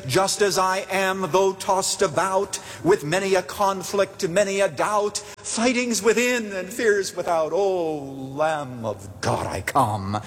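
slow, high-pitched recital sinking on the last line; hymn recitation. Acted. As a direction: recite it like hymn verse, drawn out. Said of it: "as the words of the hymn say".

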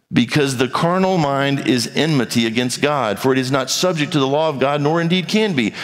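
ordinary talk

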